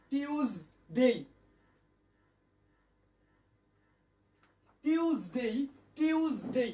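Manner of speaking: টিউজডে as "tyooz-day", buzzed z; teacher's model of টিউজডে, two distinct beats; z pronunciation. Shown as a demonstration. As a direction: sound a y glide and a z in টিউজডে. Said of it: "বর্গীয় জ হবে".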